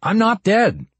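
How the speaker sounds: high, insistent objection; alarmed, high-pitched denial; desperate protest. Acted. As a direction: protest urgently that they are wrong. Protests being declared dead.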